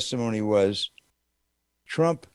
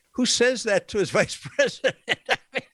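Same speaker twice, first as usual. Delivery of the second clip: laughing in disbelief; 10 semitones higher than usual. Real, not acted. Mocking Trump's crude talk.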